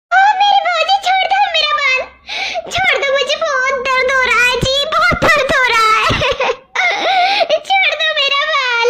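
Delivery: high, whimpering cries to let go; crying in pain. Acted. Girl begging her sister-in-law to release her hair, crying. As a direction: beg through the pain, tearful.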